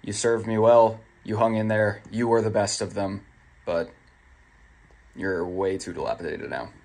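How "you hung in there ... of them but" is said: ordinary talk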